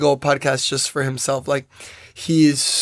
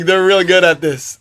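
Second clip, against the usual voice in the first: louder, playful mocking praise; mock admiration; 3 semitones higher, 5 dB louder than usual. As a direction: pretend to be impressed, teasing. Mocking believers, amid laughter.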